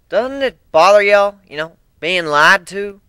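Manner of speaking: suspicious country twang, drawn out; Southern drawl. Acted. Applejack warning the crew about their liar captain, Southern twang.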